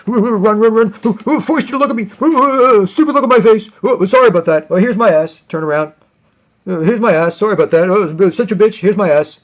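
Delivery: high, loud frantic babble; panicked fleeing coward. Retold or imitated. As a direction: babble like a scared runaway. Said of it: "they'll be like".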